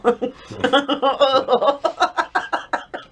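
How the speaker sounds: high squealing laugh of disgust. Real, not acted. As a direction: laugh out in revulsion. Tasting gross jelly bean.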